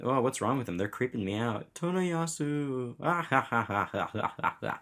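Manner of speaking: forced laughing citizen. Acted.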